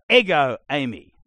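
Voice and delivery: "Ego, Amy." said slow and loud, stress on Ego; Greek ego eimi. Shown as a demonstration. Teaching Greek emphatic I am, slow and loud. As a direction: lean on "Ego". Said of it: "you go".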